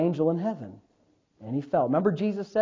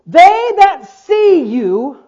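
very slow, raised proclamation; declaimed scripture; 8 semitones higher, 9 dB louder, much slower than usual. Real, not acted. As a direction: stretch the verse out with force.

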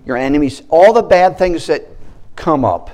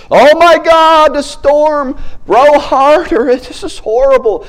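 much higher and louder, frantic yelling; panicking disciples; 13 semitones higher, 8 dB louder, slower than usual. Acted. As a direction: act out panicked rowers in a storm. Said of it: "They were panicking".

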